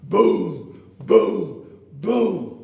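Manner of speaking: loud booming footfalls, spaced apart; elephant stomp booms. A narrator's reading. Elephant stomping over to the log, loud booms. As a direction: boom out each heavy stomp. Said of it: "the elephant stomped".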